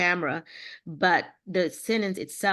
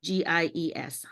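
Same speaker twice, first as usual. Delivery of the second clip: slow spelling; slower than usual. Shown as a demonstration. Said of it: "How do you say that word?".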